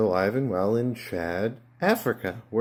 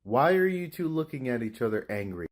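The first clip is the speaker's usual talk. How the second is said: ordinary talk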